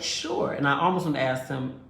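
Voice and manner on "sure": high, breathy little sure; mock sweet sure. She agrees to his bedtime suggestion, high and airy. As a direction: squeak it out, faking agreement.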